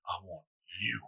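low, soft wrestler challenge; quiet, deep-pitched menace, imitating a wrestler; The Rock. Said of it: "a fucking The Rock voice".

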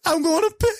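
high falsetto, imitating helpless hilarity; mom's hysterical squeal. Imitating mom overcome by the surprise box, mock hysterics. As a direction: squeal it as if laughing too hard. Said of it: "'Cause she's laughing so hard".

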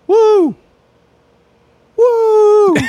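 high-pitched shrieking whoop; shrieking jackpot winner. Retold or imitated. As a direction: shriek it like you just won big. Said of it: "really excited ... acting".

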